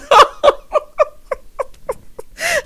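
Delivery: high, helpless giggling; real laugh. Real, not acted. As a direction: crack up in a long, high laugh. Cracks up over Rachel's laugh.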